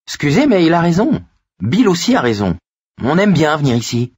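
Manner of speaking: put-on high-pitched boy speaking up; cheeky boy Richie. A narrator's reading. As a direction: pipe up in a high child's voice. (voix aiguë de gamin qui intervient)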